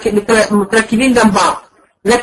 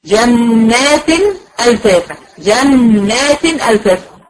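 جنات ألفافا recited very slowly, syllable by syllable; slow recitation demo; much slower than usual. Shown as a demonstration. Tajweed teacher slowly modelling the verse for students. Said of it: "مع التنوين هنقول إيه؟".

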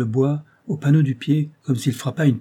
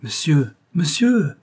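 calling out; 5 semitones higher, 4 dB louder, slower than usual. A narrator's reading. Servant calls his missing master, calling out. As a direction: call out, rising higher and louder. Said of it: "Joseph alors appela".

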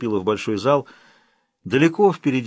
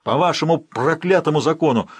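loud, raised cry with вашему falling hard; repeating the cry with more venom; angry outcry; 5 semitones higher, 4 dB louder than usual. Acted. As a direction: curse their law out loud. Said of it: "вскричал Ролло".

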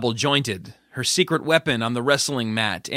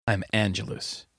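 low quiet menace; 5 semitones lower, 6 dB quieter than usual. Acted. Judith reveals her hero name.

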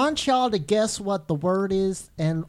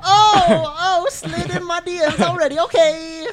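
mock crying; 11 semitones higher, 7 dB louder, slower than usual. Mock-moved reaction to compliment.

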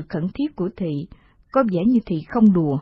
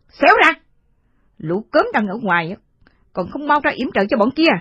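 furious high shout, then orders; cover me shout; 6 semitones higher, 4 dB louder than usual. Acted. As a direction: bark at the thugs to go cover. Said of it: "đôi mắt đỏ ngầu, đáng sợ nhất".

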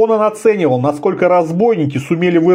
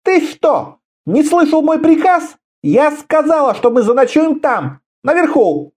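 high, imperious female rebuke; angry woman leader; 11 semitones higher than usual. A narrator's reading. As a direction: enforce the order in a high voice. Woman leader rebukes a disobedient man. (гневно командует высоким женским голосом)

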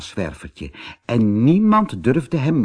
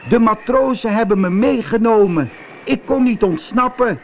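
high, loud cry of a small cat; shouting cat; 10 semitones higher, 4 dB louder than usual. Acted. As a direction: shout your excuse up to the witch, high-pitched. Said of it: "schreeuwde Zwervertje". (schreeuwende kat met hoge stem)

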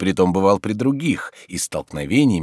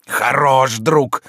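high, loud, drawn-out sneer of rebuke; angry reproach; 7 semitones higher, 7 dB louder, much slower than usual. Acted. Tarantyev scolds his ungrateful friend, angry reproach. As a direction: throw it out slowly and loudly.

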